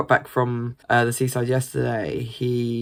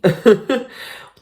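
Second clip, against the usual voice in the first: laugh; 11 semitones higher, 9 dB louder than usual. Real, not acted. Laughing at her own rant.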